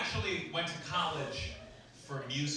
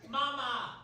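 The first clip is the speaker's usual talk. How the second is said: put-on higher, bright toddler cry of "Mama!"; baby calling mama; 12 semitones higher than usual. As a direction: call out like a baby's first word. Baby's first word.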